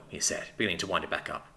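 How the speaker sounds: ordinary delivery, nothing marked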